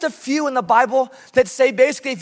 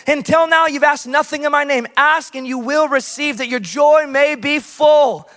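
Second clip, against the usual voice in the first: preaching at full force; 3 semitones higher, 5 dB louder than usual. Acted. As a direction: build to a proclaimed climax.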